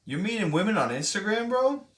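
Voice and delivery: can't believe what chat just wrote; incredulous. Real, not acted.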